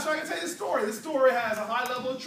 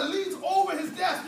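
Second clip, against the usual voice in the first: ordinary talk, nothing marked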